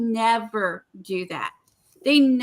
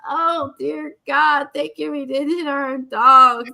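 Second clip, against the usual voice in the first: retold tearful prayer; 5 semitones higher, 5 dB louder than usual. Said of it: "I was sobbing".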